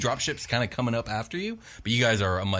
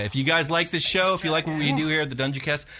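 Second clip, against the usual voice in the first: podcast outro; 6 semitones higher than usual. Acted. Outro sign-off pitch.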